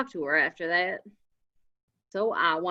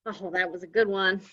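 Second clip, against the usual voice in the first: normal talking voice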